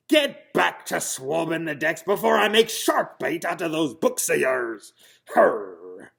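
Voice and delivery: loud pirate growl ending in a snarl; gruff threat. Acted. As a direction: threaten him like a mean captain. Captain threatens Henry.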